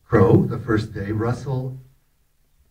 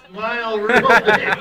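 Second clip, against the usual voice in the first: laughing while acting out a big fake smile; laughing through it; 11 semitones higher, 4 dB louder than usual. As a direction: say it through a laugh, grinning wide. Forced smile for the camera.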